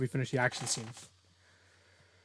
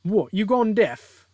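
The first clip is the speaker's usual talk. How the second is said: high, loud, slow British attempt; badly faked British jeer; bad British accent; 8 semitones higher, 7 dB louder, slower than usual. Villain jeering at the silent hero, badly faked British accent. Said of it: "I can't do a British accent".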